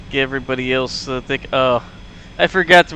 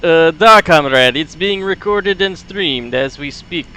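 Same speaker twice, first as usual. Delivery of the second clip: put-on light Russian accent with broken grammar; Soviet accent. Grumpnik reading the chart for comrade, light Russian accent. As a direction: stay in the comrade role. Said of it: "Soviet sound voice".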